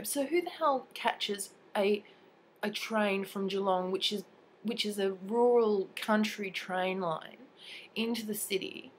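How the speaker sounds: ordinary talk, nothing marked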